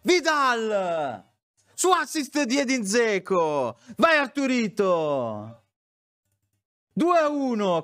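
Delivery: screaming goal call, rising high; breathless italian goal commentary. Real, not acted. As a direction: yell the scorer's name in ecstasy.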